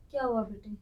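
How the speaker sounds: normal talking voice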